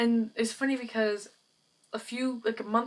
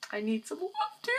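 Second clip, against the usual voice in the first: high, mock-needy little whine; playful pleading; 7 semitones higher, 3 dB quieter, faster than usual. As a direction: beg viewers in a squeaky pretend plea. Playful plea to viewers for likes.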